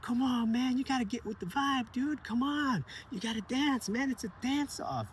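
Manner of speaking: put-on high, pushy kid egging a friend on; a boy shaking his friend by the shoulder, urging him to dance, high and hyped. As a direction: pitch way up and push him to dance. Sebastian urging Mateo.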